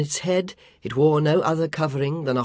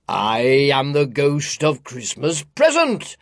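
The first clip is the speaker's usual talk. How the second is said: louder, resonant spirit voice; echoing ethereal energy being; 5 dB louder than usual. A narrator's reading. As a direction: announce yourself as a giant spirit. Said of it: "said the spirit".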